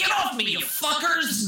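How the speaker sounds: high hammed-up shriek; mock gangster yell. Retelling, Ray Liotta's high mock shriek at the cubs. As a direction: squeal at the cubs.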